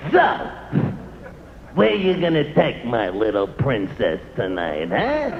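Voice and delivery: low, soft, menacing dad voice; a stern father sizing up the boyfriend. Retold or imitated. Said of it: "the father starts to talk".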